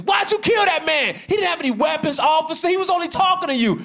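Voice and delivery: high-pitched, distressed mock protest; outraged bystander. Bystander confronting an officer over a killing, high outraged protest. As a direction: plead in disbelief with the officer.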